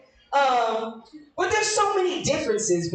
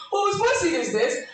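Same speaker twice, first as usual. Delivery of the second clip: dude asking; 8 semitones higher than usual. Retold or imitated. Comic mocking the next dude's possessive question. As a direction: ask it like a possessive lover. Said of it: "he gonna ask the same thing".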